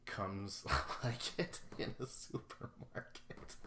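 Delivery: words half-laughed, breathy with laughter; real laughter breaking through speech; laughing. Real, not acted.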